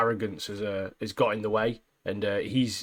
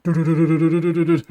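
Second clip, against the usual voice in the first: rapid du-du-du gunfire sound effect, louder and higher; gatling gun noise; 5 semitones higher, 7 dB louder, faster than usual. Retold or imitated. Mimicking a Gatling gun for a friend, rattling gunfire noise. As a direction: rattle it off like a machine gun spraying an office.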